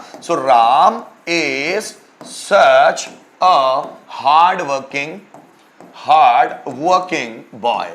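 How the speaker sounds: very slow, each word spaced; slow dictation. Shown as a demonstration. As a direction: read it out slowly for students to write. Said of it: "teacher dictates example sentence".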